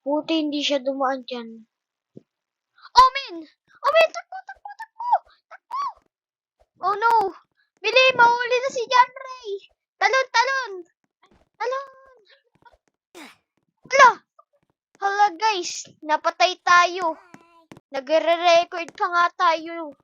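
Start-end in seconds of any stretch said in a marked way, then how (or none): 2.9-5.8 high panicked cry to run; frantic, fleeing in a panic; panicked yelling. Real, not acted. Being chased, panicking. 6.8-20.0 high, alarmed game reaction; excited shouting. Real, not acted. Caught by the seeker.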